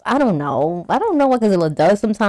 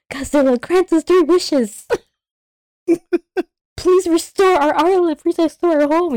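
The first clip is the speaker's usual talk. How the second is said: high, begging chorus voice; pleading villagers; 9 semitones higher than usual. Retold or imitated. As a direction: beg humbly like villagers. Islanders pleading with Godzilla.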